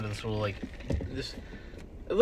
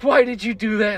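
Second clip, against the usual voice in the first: mom scolding; 12 semitones higher, 11 dB louder than usual. Retold or imitated. Mom scolding, voiced in story.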